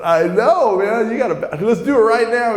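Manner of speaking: high, loud excited insistence; eager. Retold or imitated. New convert pressing teacher for baptism, eager. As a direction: re-enact bursting eagerness.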